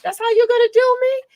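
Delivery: high, loud mock outrage; high-pitched hurt surprise; indignant disbelief. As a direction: squeal it like you can't believe the betrayal. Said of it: "I was like".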